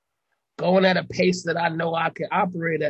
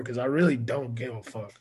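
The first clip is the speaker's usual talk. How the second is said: normal talking voice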